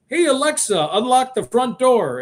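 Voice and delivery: mock villain ordering the smart speaker, slowed; bad guy voice. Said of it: "you have a bad guy go".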